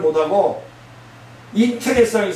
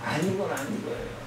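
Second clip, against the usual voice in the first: 아닌 건 아닌 거예요 said much softer, almost to himself; hushed repeat; 10 dB quieter than usual. Acted. Preacher repeating his point to the congregation after a pause, quiet and grave. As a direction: drop to a near murmur on 아닌 건 아닌 거예요.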